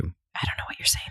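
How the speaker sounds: unvoiced mock whisper; barely audible whisper. Mocking a quiet talker.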